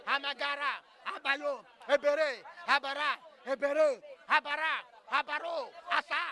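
loud fervent tongues, shouted syllables; praying in tongues. Real, not acted. Preacher praying in tongues loudly over the congregation. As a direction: pour it out in tongues at full force.

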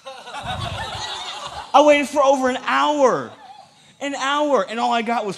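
loud, high, drawn-out indignant complaint; mock outrage. Acting out his outrage, laughing.

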